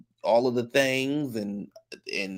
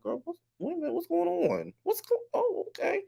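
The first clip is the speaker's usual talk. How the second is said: very high, softened squeak of confusion; baffled reaction; 8 dB quieter than usual. Retold or imitated. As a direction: act out bewilderment in a high, small voice. Retells own baffled reaction.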